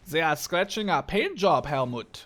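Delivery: put-on German-accented game voice line; punchy German accent. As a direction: play a German tank crewman complaining. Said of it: "the best German accent I've ever done".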